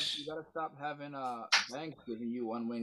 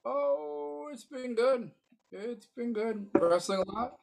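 much higher squeaky answer; 11 semitones higher, slower than usual. Acted. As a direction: squeak it out high. Squeaky high reply.

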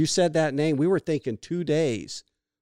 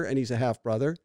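ordinary talk, nothing marked

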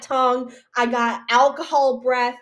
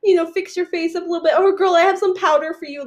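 put-on helpful girl; 7 semitones higher than usual. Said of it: "She's like".

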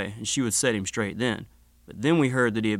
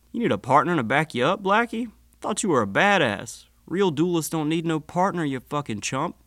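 mocking taunt; 6 semitones higher than usual. A narrator's reading. Husband taunts wounded Blackie.

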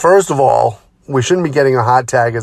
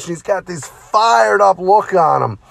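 normal talking voice

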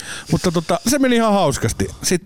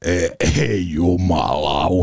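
dismayed groan; 10 semitones lower, much slower than usual. Retold or imitated. Told he must co-host.

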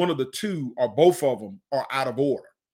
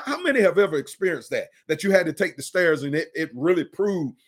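ordinary talk

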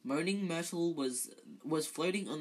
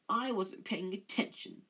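higher-pitched, mildly dramatic; a touch of ghostly melodrama; 5 semitones higher than usual. A narrator's reading. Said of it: "said Myrtle dramatically".

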